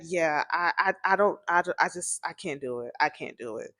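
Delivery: mild exasperated resignation; overwhelmed, quiet. Retold or imitated. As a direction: trail off in defeat. Narrator overwhelmed by noise.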